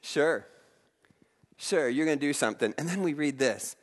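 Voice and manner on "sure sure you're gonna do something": skeptical. Retold or imitated. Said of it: "standing back going".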